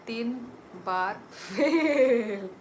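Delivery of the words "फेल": फेल stretched long and loud; drawn-out emphasis. Real, not acted. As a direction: hold the vowel of फेल, pushing it out loudly. Teasing announcement of three failures.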